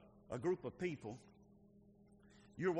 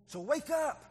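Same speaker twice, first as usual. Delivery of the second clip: calling out; 9 semitones higher, 7 dB louder, slower than usual. Real, not acted. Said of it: "preacher calls church awake".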